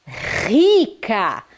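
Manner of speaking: "rica" com o R inicial forte e aspirado. Shown as a demonstration. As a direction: diga "rica" com R potente, de garganta. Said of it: "o som do R ficou potente, ficou forte". (erre forte no início de "rica")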